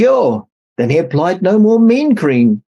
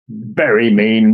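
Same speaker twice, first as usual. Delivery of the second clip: lower, slow snake rattle; low rattled snake; 5 semitones lower, slower than usual. A narrator's reading. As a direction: drag it out like a rattle. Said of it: "rattled Jake".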